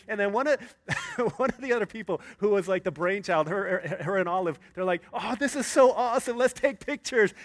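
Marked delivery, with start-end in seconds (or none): 5.1-7.3 excited gushing. Retold or imitated. Friends gush over rainy beach.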